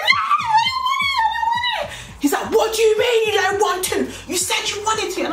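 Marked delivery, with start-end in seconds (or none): 0.0-2.2 very high, retold scream of protest; high-pitched frantic screamed refusal. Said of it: "and I screamed". 2.5-5.2 high, heated acted-out screaming; raised, high-pitched angry retort. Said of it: "he is screaming".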